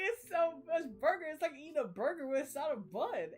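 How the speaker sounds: high, loud sing-song imitation; exaggerated comic quote, swooping up and down; funny character voice. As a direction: mimic her big and high, amused. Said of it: "that was so funny to me".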